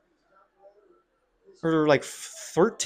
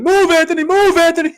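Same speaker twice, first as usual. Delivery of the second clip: much higher, much louder yelled command; referee shouting; 12 semitones higher, 10 dB louder than usual. Retold or imitated. Referee yelling at a beaten fighter to move, loud shout. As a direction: bark it like a ref urging a fighter. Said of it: "Jason Herzog was saying".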